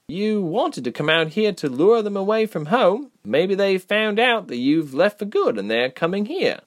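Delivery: high-pitched, hopeful character voice; Reshma, encouraging. A narrator's reading. Reshma reassuring worried Ilse, encouraging. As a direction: lift the voice to offer hope.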